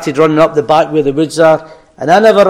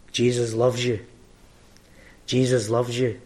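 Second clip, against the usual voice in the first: tender; 4 semitones lower, 11 dB quieter than usual. Acted. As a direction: let it land gently, almost whispered to the heart. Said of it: "But God shouts".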